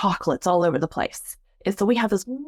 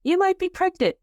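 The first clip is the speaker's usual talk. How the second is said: little inner voice; 9 semitones higher than usual. Retold or imitated. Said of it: "and it's like".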